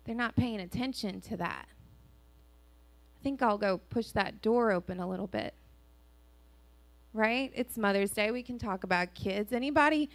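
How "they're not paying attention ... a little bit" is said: a lightly voiced, softer scheming enemy; sneaky enemy. Pastor voices the enemy sneaking through a door, softly. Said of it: "And he said".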